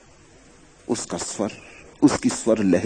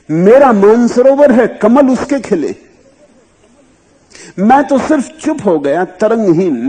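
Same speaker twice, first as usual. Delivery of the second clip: high, loud, ringing declaration; fervent sermon voice; impassioned preaching; 11 semitones higher, 9 dB louder than usual. Acted. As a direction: proclaim the saint's words with fervour. Impassioned speaking as the saint.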